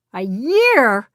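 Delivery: loud incredulous yell. A narrator's reading. Woodrow yelling at Edmund about waiting a year. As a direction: yell it in disbelief. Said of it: "Woodrow yelled".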